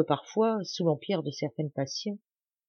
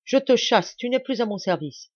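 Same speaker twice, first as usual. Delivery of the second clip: angry dismissal; 6 semitones higher, 5 dB louder than usual. A narrator's reading. Master dismisses gardener in rage.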